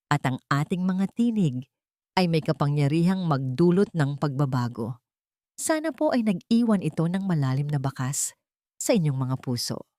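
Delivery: ordinary delivery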